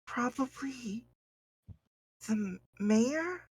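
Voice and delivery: high, slow, unsure voice; hesitant small voice, trailing up; nervous young woman. Acted. NPC girl answering.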